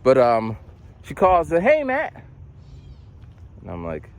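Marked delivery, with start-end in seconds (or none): 1.6-2.1 high-pitched caseworker greeting; Chloe. Retold or imitated. Caseworker calling Matt, high perky imitation. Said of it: "So Chloe calls".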